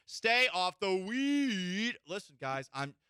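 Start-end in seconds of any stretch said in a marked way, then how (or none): 0.1-2.0 loud, high, drawn-out sports-pundit outburst; Stephen A. Smith. Acted. Host quoting a pundit to himself, loud impression. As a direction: bellow it slowly, stretching the last word. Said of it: "in the words of Stephen A. Smith".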